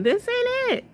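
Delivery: mock sing-song dismissal; comic high-pitched verdict; high squeal. Real, not acted.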